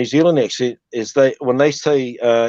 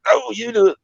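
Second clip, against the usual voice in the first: high-pitched reluctant outback guy; outback bloke; 9 semitones higher than usual. Acted. Comedian voicing camera-shy outback men, high reluctant bloke. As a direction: wave off the camera in a higher voice. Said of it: "they don't wanna be on film".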